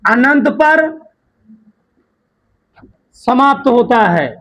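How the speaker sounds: slow, spaced-out dictation for note-taking; unhurried dictating teacher. Shown as a demonstration. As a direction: read each phrase slowly so students can write. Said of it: "मैं आराम आराम से बोल रहा हूं".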